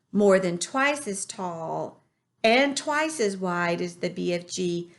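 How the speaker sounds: plain reading voice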